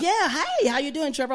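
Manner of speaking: high, soft, lilting hello; campy barista greeting. Acted. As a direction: greet a regular in a light affected voice.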